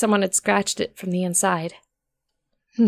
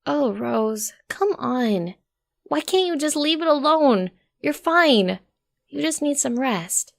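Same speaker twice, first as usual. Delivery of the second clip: Daisy, sighing; 5 semitones higher, slower than usual. A narrator's reading. Daisy sighing at Rose to let it go, pleading. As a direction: gently beg her to let it go. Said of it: "then Daisy sighed".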